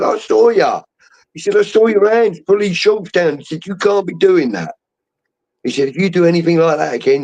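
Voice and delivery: a hint of a ref telling off; stern referee.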